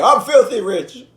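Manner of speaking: loud, high imitated boasting; mock bragging shout; Charles Barkley. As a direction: crow it across the blackjack table.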